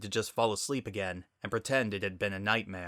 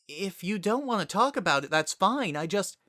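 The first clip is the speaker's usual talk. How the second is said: much higher, hurried gentle voice; apologetic Twilight; 10 semitones higher than usual. Acted. Twilight telling Sunset she need not talk, high and gentle. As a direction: back off quickly and kindly.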